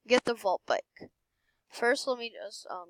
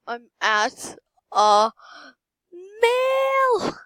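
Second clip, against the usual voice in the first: loud build to high squeal; triumphant yell; 10 semitones higher, 7 dB louder, much slower than usual. Real, not acted. Player shouting about the million, triumphant loud squeal. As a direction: punch it word by word, then squeal.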